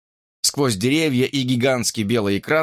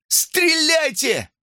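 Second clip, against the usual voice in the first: very high, stretched-out order; falsetto cry; much slower than usual. A narrator's reading. Someone urging police to fire, falsetto cry. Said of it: "Голос его взлетел на пугающий фальцет".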